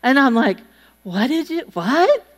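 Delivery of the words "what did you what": rising, baffled double take; confused what. Retold or imitated. Wife baffled at husband's apology, a rising double take. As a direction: pull up short and ask again, rising. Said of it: "Like that".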